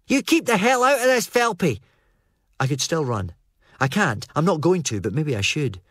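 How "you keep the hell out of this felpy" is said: angry yell. Acted. Armed Fraser yells at Felpy to back off, much higher and angry. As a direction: bark him away.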